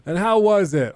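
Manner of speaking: high, mock-indignant question. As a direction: imitate an actor ranting on set.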